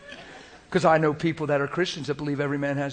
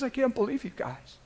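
Jesus hurt and disappointed; 6 semitones higher, 4 dB louder than usual. Retold or imitated. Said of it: "And he says".

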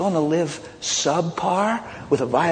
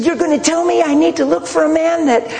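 high, heated imitation of a girl cutting in; flipping out; 13 semitones higher, 4 dB louder than usual. Girl cuts off her pastor mid-counsel, imitated high, flipping out. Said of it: "she just flips out on me".

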